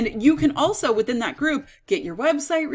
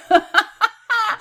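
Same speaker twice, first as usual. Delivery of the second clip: high burst of laughter; laughing; 10 semitones higher, 5 dB louder than usual. Real, not acted. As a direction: laugh at your own joke. Laughing at own infomercial bit.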